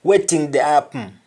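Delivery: "Waiting the happen" as "wetin de apm"; Nigerian pronunciation. Shown as a demonstration. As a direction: hum the end of HAPPEN. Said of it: "Just like Nigerians say".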